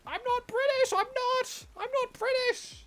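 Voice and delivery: high, pinched fake accent denying being British; put-on accent. Streamer denying to chat he's British, high silly voice. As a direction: squeak out the denial in a silly voice. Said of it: "doing voices is fun".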